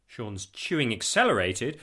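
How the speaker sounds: ordinary delivery, nothing marked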